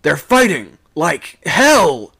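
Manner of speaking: loud, high, slow yell in bursts; urgent, broken-up holler; gasping shout. A narrator's reading. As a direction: shout it in gasps between breaths. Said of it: "shouted out all at once in gasps".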